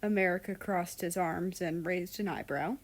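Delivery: ordinary delivery, nothing marked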